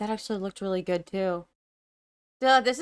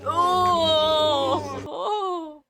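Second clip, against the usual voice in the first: sudden high surprised cry; startled gasp; 6 semitones higher, 5 dB louder than usual. Real, not acted. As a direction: jump at the sight. Startled by the creature growing.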